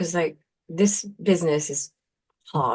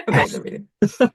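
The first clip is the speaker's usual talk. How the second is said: laughing; 11 semitones higher, 5 dB louder than usual. Real, not acted. Laughing about converting friends.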